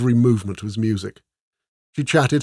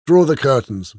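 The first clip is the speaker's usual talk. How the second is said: loud, raised command; lordly order; 4 semitones higher, 7 dB louder than usual. A narrator's reading. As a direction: order it as the gruff lord. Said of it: "my Lord of Rushton ordered".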